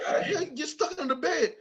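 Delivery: high-pitched mock angry dad; scolding father. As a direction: play a woken dad scolding his boy. Voices father scolding the child.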